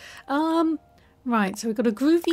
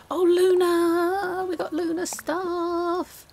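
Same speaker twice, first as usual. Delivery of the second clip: high, drawn-out excited squeal; slow, high-pitched thrill; delighted cooing; 7 semitones higher, slower than usual. Real, not acted. Delighted at finding Luna items.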